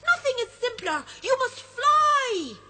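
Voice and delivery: high, loud cheeky sprite voice; a mischievous sprite teasing the friends. Acted. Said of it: "he says".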